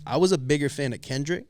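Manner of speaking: ordinary delivery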